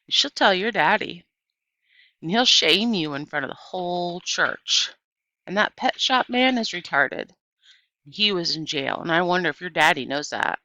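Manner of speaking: put-on loud, jeering boy; shouting taunts. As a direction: keep yelling the threats. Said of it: "shouted Stevie".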